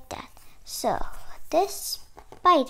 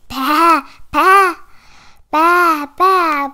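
loud, raised-pitch baas; sheep bleating; 4 semitones higher, 12 dB louder, slower than usual. Acted. Voicing the sheep in a picture book, loud bleating. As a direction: bleat each one out like a sheep. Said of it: "bleated the sheep".

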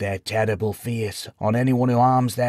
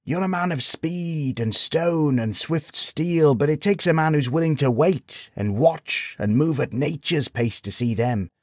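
lightly voiced sage, gently regretful; note of sadness; 6 semitones higher than usual. A narrator's reading. Sage gently corrects the travelling knight, a touch of sadness. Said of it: "a note of sadness in his voice".

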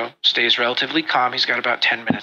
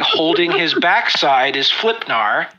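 ordinary talk, nothing marked

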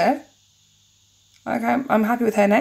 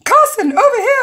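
high, loud put-on squeal of a call; overexcited fan voice; 9 dB louder than usual. Fan calls to the host Carson, high put-on voice. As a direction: call out like an eager fan, hammed up. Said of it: "didn't mean to give the voice".

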